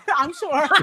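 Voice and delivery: laughing through the words; real laugh breaking through. Real, not acted. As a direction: giggle while insisting. Laughs through her answer.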